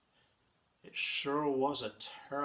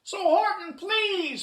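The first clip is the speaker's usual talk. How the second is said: high, loud little plea; squeaky mayor imploring; pleading; 11 dB louder, slower than usual. A narrator's reading. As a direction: beg in a tiny piping voice. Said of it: "pleaded the voice".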